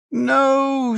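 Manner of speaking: shrill, very high anguished scream of no; a daughter shrieking at news of her father's death. A narrator's reading. The daughter hearing her father is dead, screams in high anguish. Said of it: "she screamed".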